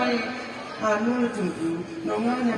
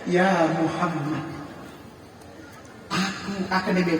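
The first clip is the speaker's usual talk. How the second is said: tender wife; 5 semitones lower, 7 dB quieter than usual. Acted. Khadijah softly.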